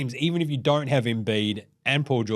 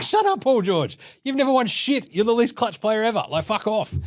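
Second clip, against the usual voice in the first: mock rant; 7 semitones higher than usual. Mock rant at Paul George.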